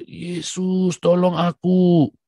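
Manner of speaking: loud, slow cry for help; desperate plea to Jesus. Shown as a demonstration. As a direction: call out to Jesus with everything. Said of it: "kamu cuma cukup teriak".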